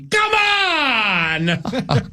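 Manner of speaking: high, drawn-out holler; imitated exasperated shout. Mimicking Nordo's yell heard ten miles away, drawn-out shout. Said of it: "I think I heard the".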